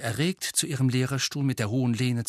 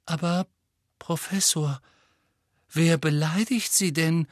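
hesitant, higher questioning; timid; 6 semitones higher than usual. A narrator's reading. Pavati asks the upset teacher who insulted her, timid and hesitant. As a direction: ask carefully, afraid of the answer. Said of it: "fragte Pavati zaghaft".

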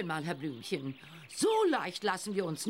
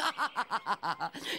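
hearty laugh. Acted. Reporter laughs at own joke.

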